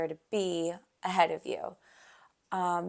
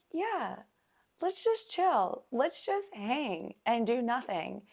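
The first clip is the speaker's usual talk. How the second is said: high, drawn-out quoted agreement; retold easygoing agreement; 5 semitones higher, slower than usual. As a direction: agree to laze about, slow and high-pitched. Quotes own agreement to relax.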